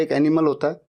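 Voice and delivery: ordinary talk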